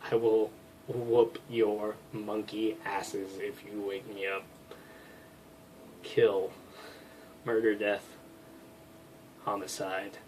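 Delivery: low, soft, half-asleep menace; low sleepy threat. Acted. Groggy roommate warns she will hurt whoever wakes her, low and soft. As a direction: growl it out barely awake.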